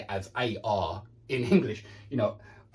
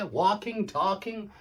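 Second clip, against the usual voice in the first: 1 American walking talking; 4 semitones higher, slower than usual. Shown as a demonstration. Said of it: "trying to say it how I think they say it".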